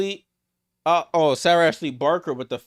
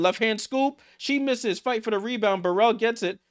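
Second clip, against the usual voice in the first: higher-pitched rapid calling of the scramble; excited call; 7 semitones higher than usual. Real, not acted. Streamer calling a fight for the rebound, high excited call. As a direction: keep the energy up through the loose ball.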